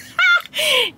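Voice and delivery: high giggly laugh; flirty giggle. Real, not acted. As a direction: giggle playfully. Flirty laugh about manager.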